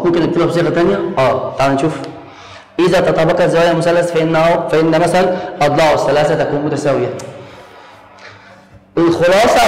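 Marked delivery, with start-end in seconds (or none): none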